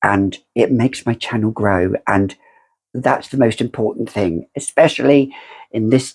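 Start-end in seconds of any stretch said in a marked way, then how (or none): none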